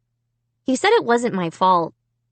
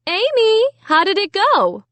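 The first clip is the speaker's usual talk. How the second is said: mom calling across the house; calling from the kitchen; 10 semitones higher than usual. A narrator's reading. Mom from the kitchen to Amy, calling out. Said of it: "Mom called from the kitchen".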